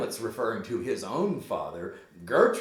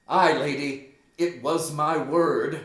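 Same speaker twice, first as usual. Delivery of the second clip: firm accusation; 5 semitones higher, 8 dB louder than usual. Acted. Hamlet confirms the accusation to his mother, loud and deliberate.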